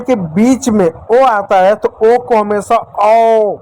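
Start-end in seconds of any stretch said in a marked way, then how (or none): 2.4-3.6 "औ" drawn out, open and rounded; slow aw sound. Shown as a demonstration. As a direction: stretch "औ" slowly for the class. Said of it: "औ की मात्रा पढ़ते हैं". ("औ" को धीरे और खींचकर)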